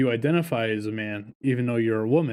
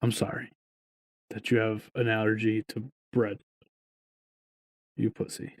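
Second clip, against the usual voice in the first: softer, lower, fading into a whispered insult; mock apology; 4 semitones lower, 6 dB quieter than usual. To absent coworker, mock sincere apology ending in whisper. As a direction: fake sorry, then whisper the jab. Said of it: "I just wanna say".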